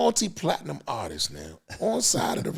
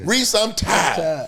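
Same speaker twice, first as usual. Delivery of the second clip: slow, raised worn-out plea; slow tired drawl; 6 semitones higher, 5 dB louder, much slower than usual. Retold or imitated. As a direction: stretch it out wearily. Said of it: "another slogan".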